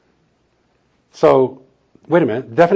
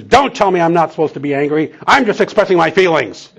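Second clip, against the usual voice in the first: higher and louder, a mock defensive protest; indignant retort; 6 semitones higher, 6 dB louder than usual. Wife defending her anger to the counselor, imitated indignation. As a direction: snap back defensively, imitated. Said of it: "she turns to me and says".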